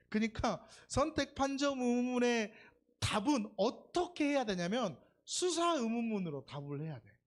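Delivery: ordinary delivery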